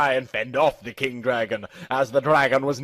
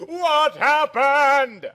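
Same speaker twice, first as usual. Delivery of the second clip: scream; 11 semitones higher, much slower than usual. A narrator's reading. Girl screaming at the first mate for news, scream. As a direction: scream it out in desperation. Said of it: "I screamed again".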